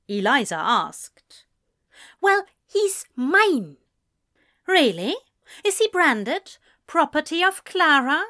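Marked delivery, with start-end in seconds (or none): 2.2-3.8 high, loud, drawn-out claim; possessive retort. A narrator's reading. Girlfriend quarrelling over her boyfriend, indignant possessive retort. 4.7-8.2 high, swooping mock questions; mocking incredulity. A narrator's reading. Friend ridiculing a possessive girlfriend, mocking disbelief.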